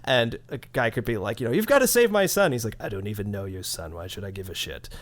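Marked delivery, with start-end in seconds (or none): 1.5-2.5 higher, louder plea; desperate quest giver. Retold or imitated. 2.8-4.9 lower, softer, deadpan British robotic drone; dry British agent. Retold or imitated. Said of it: "this British voice ... super dry delivery".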